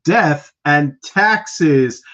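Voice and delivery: slow, drawn-out show opener; announcer intro. Acted. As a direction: announce the show like a host on air.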